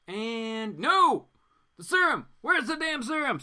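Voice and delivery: high, loud panic over the missing serum; frantic, searching in alarm; panicked. Acted. Reading a comic, he voices Green Arrow panicking over the serum, loud and high.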